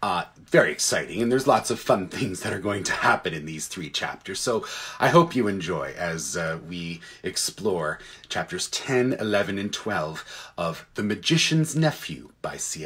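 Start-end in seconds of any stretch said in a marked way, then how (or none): none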